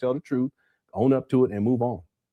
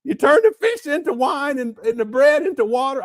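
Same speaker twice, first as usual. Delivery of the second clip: high, loud mock woman's voice; mocking her; 11 semitones higher, 6 dB louder than usual. Mocking her miracle claims to viewers, in a high voice. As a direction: lay out the accusation up high as a send-up.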